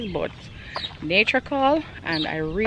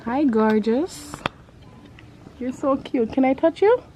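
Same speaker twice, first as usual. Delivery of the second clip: high, gentle coo to the horse; 6 semitones higher than usual. Real, not acted. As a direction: sweet-talk the horse. Talking to the horse.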